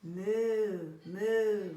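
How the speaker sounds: loud mooing cow. Acted. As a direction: moo out loud like a happy cow. Animals cheer in the story.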